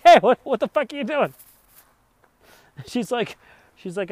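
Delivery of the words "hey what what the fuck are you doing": high, loud, indignant shout; imitating outraged Jerry. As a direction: yell as Jerry watching his money fly. Jerry outraged in retold scene.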